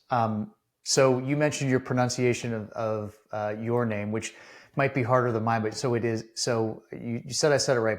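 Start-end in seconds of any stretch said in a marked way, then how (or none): none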